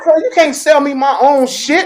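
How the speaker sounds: high, loud, drawn-out retort; mock indignation. Acting out his reply to seller.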